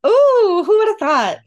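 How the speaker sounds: high, loud mock surprise; tongue-in-cheek snark. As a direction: feign amazement with playful sarcasm. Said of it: "That was tongue-in-cheek".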